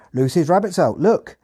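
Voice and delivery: high, loud rural Hampshire farmer voice; thick Hampshire accent. Retold or imitated. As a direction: call it out like a Hampshire farmhand. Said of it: "in a thick Hampshire accent".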